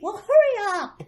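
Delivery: high, loud, impatient push. Acted. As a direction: Mink hurrying Anna along, impatient. Impatient child urges friend.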